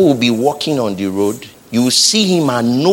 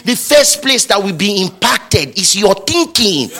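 climbs higher and louder line by line until near shouting; preaching, building; 10 semitones higher, 5 dB louder than usual. Acted. Preacher rousing the congregation, rising to a loud climax. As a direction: build the congregation up to a roaring peak.

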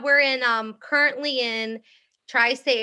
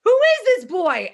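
mock yell; 11 semitones higher, 6 dB louder than usual. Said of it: "I was like".

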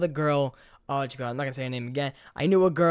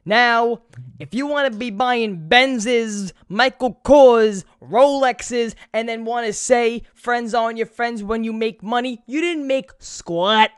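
heated rant; 5 semitones higher, 6 dB louder, slower than usual. Real, not acted. Ranting at trust fund kids.

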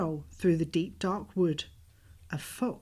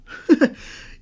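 big monster laugh; 11 dB louder than usual. Acted. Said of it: "bursting with laughter".

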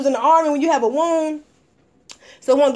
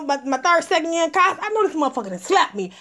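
ordinary delivery

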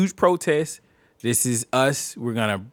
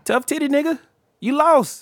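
mocking taunt; 12 semitones higher than usual. Taunting the losing side.

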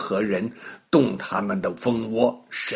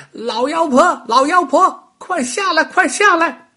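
high, loud taunting call to the witch; bunny calling out; 14 semitones higher, 6 dB louder than usual. A narrator's reading. Little rabbit calls the old witch down, high and loud. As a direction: shout up at her, teasing. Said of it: "哇哇地叫了起来".

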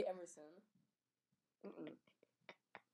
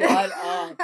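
high, loud disbelieving yelp; shocked shut up; 9 semitones higher, 8 dB louder than usual. Real, not acted. Reacting to a friend's story, a high shocked yelp. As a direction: burst out in disbelief.

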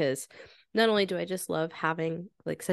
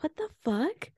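surprised; 8 semitones higher, 3 dB quieter than usual. Retold or imitated. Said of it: "And I was like".

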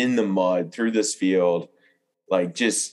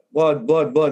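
mock calling out blood; 7 semitones higher than usual. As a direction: call it out each time you spot it.

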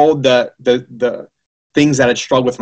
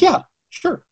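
teachers answering in a much higher, easy voice; high breezy yeah sure; 13 semitones higher than usual. Retold or imitated. Teachers casually agreeing to sign, in a high light voice. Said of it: "They're like".

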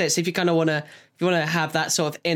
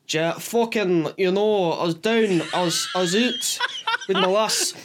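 slack-jawed Glaswegian slur; drunk Glaswegian accent. Acted. Demonstrating how drunk Glaswegians talk, slack-jawed Scottish accent. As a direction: imitate a drunk Glaswegian, words dragging. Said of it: "when they get drunk, it's like".

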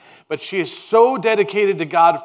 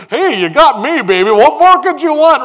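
higher and louder mock husband, teasing; clueless husband; 10 semitones higher, 11 dB louder than usual. Pastor mocking Elkanah comforting Hannah, higher and louder. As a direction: ham up the smug husband consoling his wife. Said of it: "The man's like".

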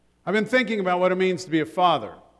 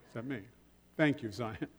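ordinary talk, nothing marked

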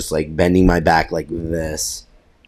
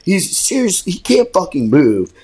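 high mocking buddy voice, pitched way up; mock teammate voice; 9 semitones higher than usual. Teammate mocking the injured player in a high voice. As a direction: ham up a teammate teasing him. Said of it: "He looks at me, he's like".

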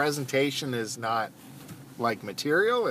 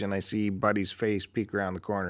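ordinary talk

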